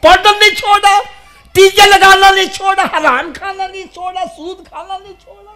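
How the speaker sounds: high, shouted sermon list, volume falling away at the end; impassioned scolding from the pulpit; full-force preaching rebuke. Real, not acted. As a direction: cry out each reproach at high pitch.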